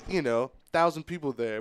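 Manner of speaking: ordinary talk, nothing marked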